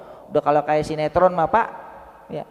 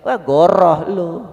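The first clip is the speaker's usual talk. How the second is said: scornful retort; 5 dB louder, slower than usual. Acted. Allah cutting off the excuse.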